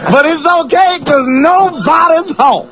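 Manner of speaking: high-pitched stand-up holler; Chris Rock. Acted. Host riffing on the bedroom bit, Chris Rock impression. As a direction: deliver it as a shouting comedian. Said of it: "That's Chris Rock".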